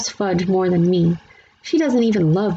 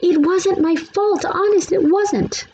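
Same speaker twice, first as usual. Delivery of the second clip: crying; 12 semitones higher, slower than usual. A narrator's reading. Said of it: "Sheila cried".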